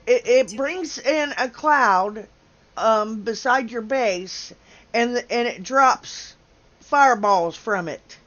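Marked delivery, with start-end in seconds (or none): none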